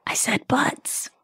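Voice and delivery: a naughty aside, voiceless and soft; giggly whisper. Acted. Podcast host sneaks a pun past listeners, giggling whisper. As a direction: whisper it, half laughing.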